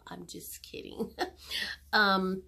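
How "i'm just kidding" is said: breathy whisper with a giggle; whispered kidding. Real, not acted. Vlogger backing off a joke, whispered laugh. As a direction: take it back with a hushed laugh.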